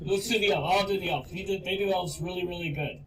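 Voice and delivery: high, loud flustered protest; flustered farmer. Acted. Farmer covers for his misbehaving dogs, loud and flustered. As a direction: scramble to save face, laughing. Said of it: "He goes".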